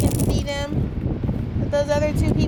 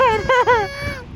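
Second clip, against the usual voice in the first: high laugh; 11 semitones higher than usual. Real, not acted. Laughs at own toes.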